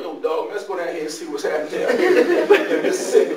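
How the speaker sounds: lightly put-on low slang voice; casual street talk. Buddies in the car coming down here, casual street talk. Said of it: "we was in the car talking about".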